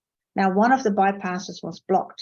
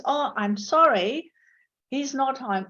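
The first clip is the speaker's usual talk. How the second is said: lightly voiced polite reply, higher and slow; polite answer; 4 semitones higher than usual. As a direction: sound sorry to say he's out. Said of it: "You'd have to say".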